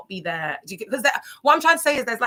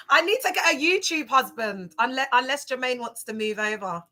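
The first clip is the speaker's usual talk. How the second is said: ordinary delivery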